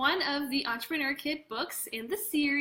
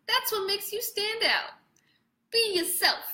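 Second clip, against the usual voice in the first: brash, higher, louder New York voice; New York accent; 8 semitones higher, 4 dB louder than usual. Acted. Producer reassuring young Jason, New York accent. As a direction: cheer him on like a fast-talking New Yorker. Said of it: "I gave him a New York accent".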